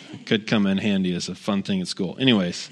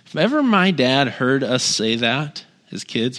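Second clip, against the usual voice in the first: normal talking voice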